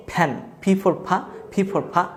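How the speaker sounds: ordinary delivery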